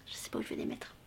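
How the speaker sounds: whispered aside. Real, not acted. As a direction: breathe it to yourself. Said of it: "aside on full shelf". (chuchoté en aparté)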